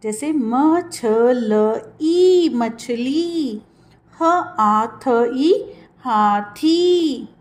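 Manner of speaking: very slow syllable-by-syllable sounding; slow spelling out. Shown as a demonstration. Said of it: "long sound".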